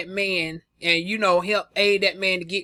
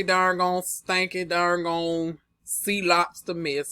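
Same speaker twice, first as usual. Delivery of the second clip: ordinary delivery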